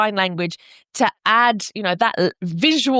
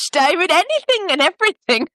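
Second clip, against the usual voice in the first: higher pitch breaking into a laugh; amused exclamation; 9 semitones higher than usual. Real, not acted. Guest answers what issues the play covers, laughing amusement. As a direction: toss it off high, then laugh.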